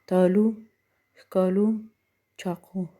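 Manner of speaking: "تالو، ښکالو، چاقو" each ending on a long oo; long waw. Shown as a demonstration. Teacher giving examples of long waw, pronunciation demo. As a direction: list "تالو، ښکالو، چاقو" word by word, drawing out the final "oo".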